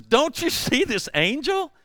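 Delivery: high, loud donkey voice; squeaky cartoon donkey warning his rider; talking donkey. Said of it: "the donkey turns around and says".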